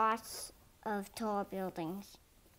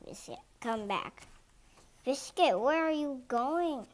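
ordinary delivery

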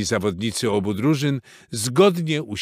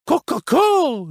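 high, loud, drawn-out cluck; clucking hens; 6 dB louder, slower than usual. A narrator's reading. Hens ignoring Reksio's appeal, clucking. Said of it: "zagdakały tylko kury".

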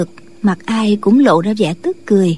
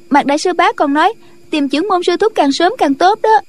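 high, earnest disciple voice; urgent young disciple; 6 semitones higher than usual. Acted. Disciple relays the elder's warning, high and earnest.

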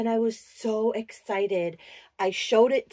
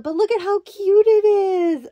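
gushing; 10 semitones higher, 6 dB louder than usual. Real, not acted. Gushing over doll hat outfit.

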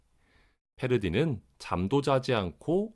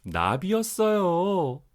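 excited reveal; 8 semitones higher, slower than usual. A narrator's reading. Big reveal of butterfly.

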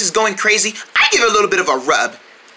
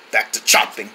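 ordinary talk, nothing marked

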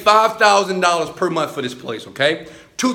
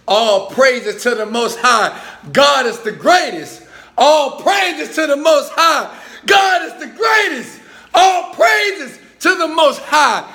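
building shout; 8 semitones higher than usual. Shown as a demonstration. Leading viewers in the chant, rising to a shout. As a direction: start firm, then yell it higher each time. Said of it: "just say".

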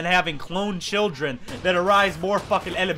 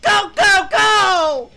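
shouting; 13 semitones higher, 9 dB louder, much slower than usual. Real, not acted. Urging on.

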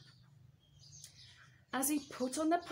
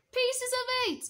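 much higher, louder squawky parrot; croaky parrot voice; 11 semitones higher, 5 dB louder than usual. Acted. Parrot heard from the port, croaky and high. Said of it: "a loud croaky voice".

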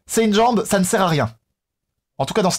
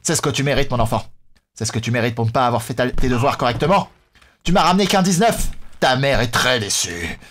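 lower-pitched mock fatherly reproach; stern father; 7 semitones lower than usual. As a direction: scold a child with grave disappointment. Voices stern father scolding his child.

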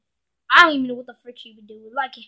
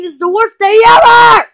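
high, loud exasperated yell; fed-up shouting; frustrated outburst; 6 semitones higher, 10 dB louder than usual. Acted. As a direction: vent at the top of your voice. Venting frustration at being stuck.

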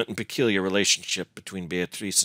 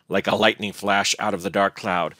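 usual speaking voice

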